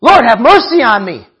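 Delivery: acted plea for mercy, projected; crying out. Beggar begs the passing Lord for mercy, crying out. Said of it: "he starts to cry out".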